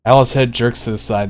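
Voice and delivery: plain reading voice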